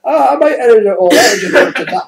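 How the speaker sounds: high, loud, squeezed out through laughter; fighting off the giggles; real laughter breaking the words; laughing while talking. Real, not acted.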